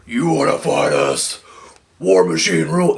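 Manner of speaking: imagined brute voice, slowed and menacing; barbarian bellow. Pretend. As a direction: growl it like a hulking brute, lightly done. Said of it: "expecting them to, like, bellow shit out".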